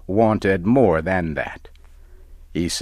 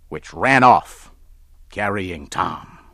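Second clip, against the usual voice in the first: ordinary delivery, nothing marked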